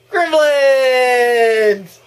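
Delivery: high, loud cry of the name; excited shout. Real, not acted. Watching gremlins swarm in, excited high shout. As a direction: shout it out thrilled.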